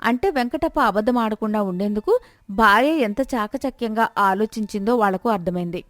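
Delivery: ordinary delivery, nothing marked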